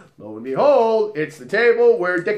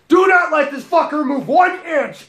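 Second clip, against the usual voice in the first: barked order, much higher and louder; staged yell; 11 semitones higher, 5 dB louder than usual. Acted. Bouncer fake-yelling at his coworker to trap the drink spiker, loud. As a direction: yell it to scare the creep in front of everyone. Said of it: "I'm gonna yell at you".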